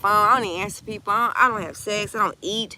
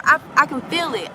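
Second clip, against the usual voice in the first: usual speaking voice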